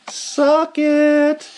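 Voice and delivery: high, drawn-out taunt; triumphant high-pitched jeer; gloating cheer. Pretend. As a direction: crow it in a high voice. Gloating after three wins.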